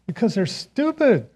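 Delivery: youthful scorn, amused at himself; laughing dismissal. Retold or imitated. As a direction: toss off the insult with a chuckle. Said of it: "I said".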